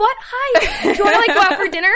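very high, fast, laughing; giddy gush. Retold or imitated. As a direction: fangirl flustered reply. Retold excited reply.

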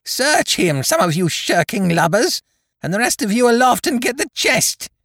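very high, furious commands; raging pirate chief's shrieked orders; swearing at the men. A narrator's reading. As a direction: berate a lazy crew at the top of your pitch. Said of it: "he cried".

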